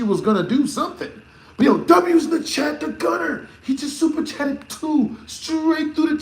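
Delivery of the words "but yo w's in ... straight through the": excited. Real, not acted. Hyping up a super chat.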